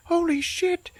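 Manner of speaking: very high-pitched gasped words; high comic shock; mock amazement. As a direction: squeak it in disbelief. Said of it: "And he's like".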